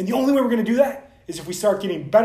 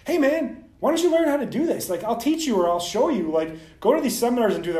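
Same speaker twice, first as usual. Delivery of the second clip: mock eager pitch, higher and louder; eager invitation; 7 semitones higher, 4 dB louder than usual. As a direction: pitch it like an excited buddy. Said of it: "And they're like".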